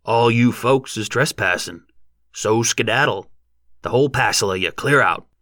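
gruff farmer ordering trespassers off; commanding rustic voice; peremptory. A narrator's reading. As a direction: order them out firmly and deliberately. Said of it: "he said peremptorily".

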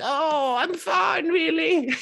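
mock frail old man insisting he's fine; squeaky old man. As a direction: protest feebly in a high little voice.

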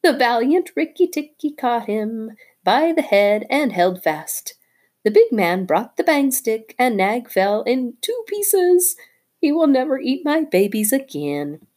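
high chirpy bird voice, bragging; a tailorbird crowing over the dead snake; excited little bird. Acted. Darzee the bird talking.

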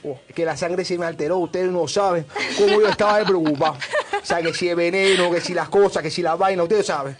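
put-on Cuban accent. Guide defending himself to the hosts, rising Cuban-accent rant. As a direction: ham up a flustered Cuban guide pleading to be understood, Cuban accent. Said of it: "no supera el acento cubano".

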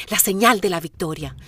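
ordinary talk

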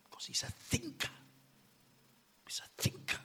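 hushed whisper, leaning in; whispered emphasis. Acted. Preacher confiding why the serpent avoided Adam, whisper. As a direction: drop to a secret whisper.

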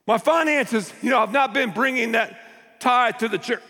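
high, earnest pleading; a touch of anxious prayer. Acted. Acting troubled believer's prayer.

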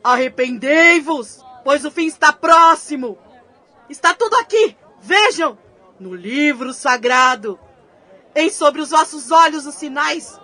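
preaching doom to sinners; mock biblical prophet. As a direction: prophet calling to repent. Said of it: "gritando trechos bíblicos em tom profético". (pregador profético)

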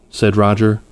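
ordinary delivery, nothing marked

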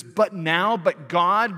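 "now" and "God" punched loud; emphatic but now. Shown as a demonstration. Preacher stressing Paul's turning words, loudly emphatic. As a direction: hit "now" and "God" hard. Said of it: "is emphatic".

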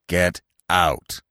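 low, menacing order; angry command. A narrator's reading. Carus confronting his rival Bambi, angry. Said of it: "Carus commanded angrily".

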